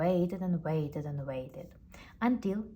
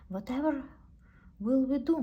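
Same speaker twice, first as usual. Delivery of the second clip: worried; 7 semitones higher, 4 dB louder than usual. Acted. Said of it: "worried Stan".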